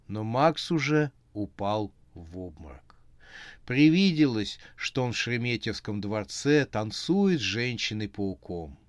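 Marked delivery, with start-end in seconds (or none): none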